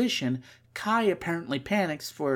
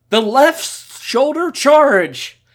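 slow, higher and louder battle cry; shouted attack name; 9 semitones higher, 12 dB louder, slower than usual. Acted. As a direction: call out the special move.

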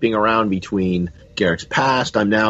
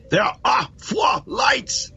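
much higher, slow, word by word; mock defiant cry; 14 semitones higher, much slower than usual. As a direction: act out a strained, defiant outcry. Quotes a famous defiant scene.

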